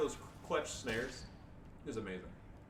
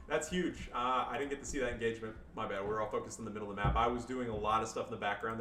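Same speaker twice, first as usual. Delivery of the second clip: ordinary talk, nothing marked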